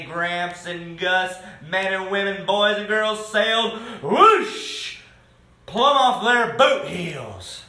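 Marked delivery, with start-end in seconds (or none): 4.0-5.0 high, loud whoosh of air; imitated whoosh. A narrator's reading. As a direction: sweep up like a gust. Imitating people blown sky-high.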